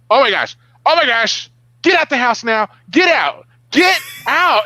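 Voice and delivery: high, loud frantic shouting; wife screaming at husband to get out; retold panicked wife. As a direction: yell it like bugs are all over him. Wife panicking about bugs.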